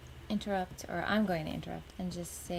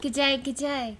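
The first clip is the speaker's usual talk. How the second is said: deliberately bad Australian accent, higher and louder; dumb Australian impression; 7 semitones higher, 6 dB louder than usual. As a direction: ham up a terrible Australian greeting. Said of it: "your dumb Australian impression".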